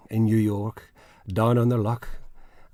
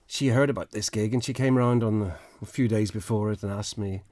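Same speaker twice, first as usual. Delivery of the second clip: usual speaking voice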